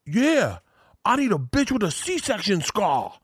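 high, slow, outrageous club yell; Tracy Morgan shouting to the club in a high put-on voice; imitating Tracy Morgan. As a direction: catcall the room like a wild celebrity. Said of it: "He's like".

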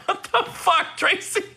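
high, giggling what the; laughing disbelief. Retold or imitated. [name] to Tiffany, recalling Tracy's hug, laughing disbelief. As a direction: squeal it through laughter. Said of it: "I was like".

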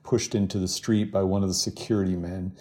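ordinary talk, nothing marked

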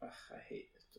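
whispered, disgusted groan. Real, not acted. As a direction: breathe it out in disgust. Disgust at caterpillar facts.

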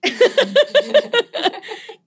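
squealing burst of laughter; high giggling squeal; high delighted laugh. Real, not acted. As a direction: shriek of laughter.

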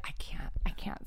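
a small whispered refusal; soft whisper, half-joking. Pretend. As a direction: whisper it like a playful secret. Mock refusal to share names.

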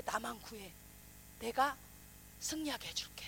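hushed unvoiced promise; whisper. The Spirit quietly promising victory to believers, whispered. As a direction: breathe it softly as a secret.

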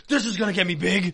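mock excitement. Younger self admiring new supplements, loud mock excitement. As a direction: hype yourself up, gently self-mocking. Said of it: "and be like".

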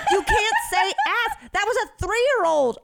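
high, loud, slow shocked scold; mock scolding. Telling off a drunk friend in front of kids, high loud mock scold. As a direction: scold her like a shocked parent.

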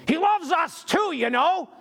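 high, drawn-out indignant holler; imitated yell. Woman calls across a store at a man's shirt, imitated yell. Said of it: "she yelled at me".

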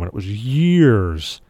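"years" stretched out slowly and weighted; stress on years. Shown as a demonstration. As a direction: drag out the word "years" for emphasis. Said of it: "emphasis on the word years".

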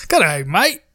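broad fake Aussie greeting; mock Australian accent. Shown as a demonstration. Co-host testing his Aussie accent on friends, mock Australian. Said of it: "All right, where's mine from?".

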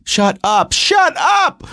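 mock-frantic, pitch leaping high; exasperated outburst. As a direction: build to a high, squealed plea for silence. Exasperated husband pleading with chattering wife.

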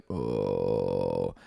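very deep, soft drone; really low voice. Retold or imitated. Demonstrating a radio host's didgeridoo-like sound, really low. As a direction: drop to the bottom register and hold it. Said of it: "in a really low voice".

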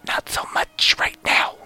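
hushed, breathy whisper under the breath; whispered aside. Real, not acted. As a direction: drop to a sly whisper. Aside about Weaver, now soured.

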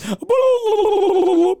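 high squeaky rewinding noise. Pretend. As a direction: make a loud tape rewind squeal. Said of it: "That's my rewind noise".

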